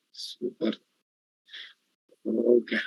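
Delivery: soft muttered approval; under the breath; whispered aside. Real, not acted. Teacher murmurs aside.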